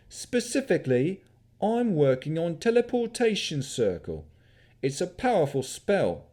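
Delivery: put-on young wizard's cheeky country yokel accent. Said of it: "His accent mimicked that of a country yokel".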